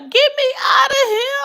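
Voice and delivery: very high, loud shout; panicked post-surgery yell; screaming. Retold or imitated. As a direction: yell to be let out. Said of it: "Why I woke up screaming".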